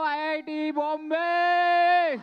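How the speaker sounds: big, slow, high call to the whole hall; stage greeting shout. Real, not acted. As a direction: greet the crowd loudly, stretching it. Guest greets crowd from stage. (भीड़ को ज़ोर से अभिवादन)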